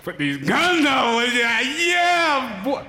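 drawn-out gym bravado shout; hyped-up shout. Pretend. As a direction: pump yourself up flexing. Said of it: "I'm getting too excited".

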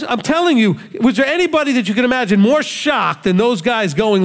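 usual speaking voice

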